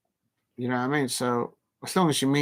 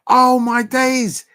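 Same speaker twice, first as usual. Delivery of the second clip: loud, high, drawn-out amazement; astonished outburst; 10 semitones higher, 9 dB louder, much slower than usual. Real, not acted. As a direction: exclaim it slowly in real disbelief. Discovers the surprising match score.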